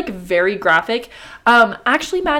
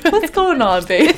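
laughing; 8 semitones higher, 7 dB louder, slower than usual. Real, not acted. Laughing at cohost's giggles.

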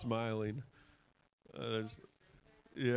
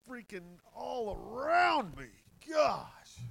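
high, drawn-out excited outburst; overflowing with excitement, pitch soaring; real excitement; 10 semitones higher, slower than usual. Real, not acted. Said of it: "I just get so excited".

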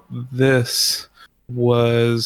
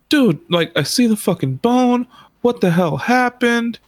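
alarmed; 10 semitones higher than usual. Retold or imitated. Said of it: "he's like".

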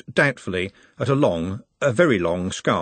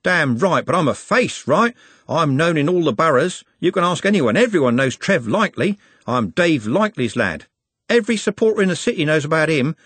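higher-pitched cocky street lad; boastful Trev, proud; 6 semitones higher, 3 dB louder than usual. A narrator's reading. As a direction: brag proudly of your name and dad.

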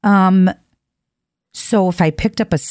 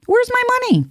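Where's pitched way up in a squeal; squeaky mock demand. Shown as a demonstration. Joking millionaire asking for cash, squeaky high Where's. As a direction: squeal the question like a cartoon.

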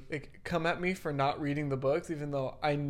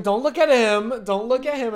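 teacher's mock command; 8 semitones higher, 11 dB louder than usual. Teacher telling the staring class to stop, urgent mock command. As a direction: call the whole class off, hammed-up.

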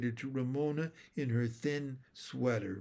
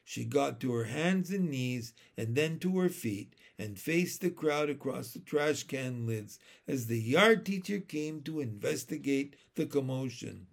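ordinary delivery

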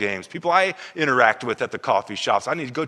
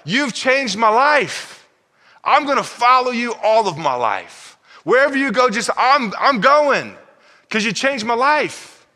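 overjoyed freed man; 10 semitones higher, 4 dB louder than usual. Acted. Freed man thanks Jesus.

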